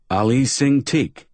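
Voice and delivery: high, slow grandiose announcement; a hint of pompous showman; grand stage name.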